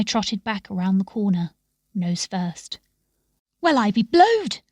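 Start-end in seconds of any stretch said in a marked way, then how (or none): 3.6-4.6 surprised exclamation. Acted. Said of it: "said Joseph".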